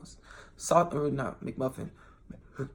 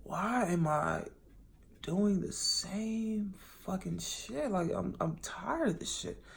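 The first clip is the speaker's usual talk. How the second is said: frustrated self-talk; 4 semitones higher, 6 dB quieter, slower than usual. Retold or imitated. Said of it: "I had to tell myself".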